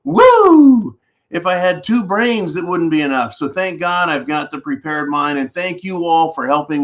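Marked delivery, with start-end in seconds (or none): 0.0-1.0 loud high whoop of excitement; excited whoop. Real, not acted. Host whooping to his channel audience, excited. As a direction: let out a big happy whoop to the viewers.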